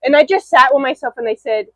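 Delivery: ordinary talk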